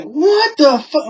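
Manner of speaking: high, drawn-out imitation of indignant mother; mock outrage. Retelling mother seeing the other cops, mock outrage.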